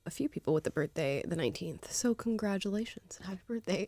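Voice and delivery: soft unvoiced whisper. Real, not acted. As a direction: whisper the wish. Whispered aside to friends.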